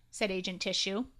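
ordinary delivery, nothing marked

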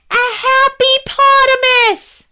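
high, slow comic guard voice; squeaky guard voice. Acted. Guard answering the princess's riddle, high comic voice. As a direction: play the guard way up high and drawn out.

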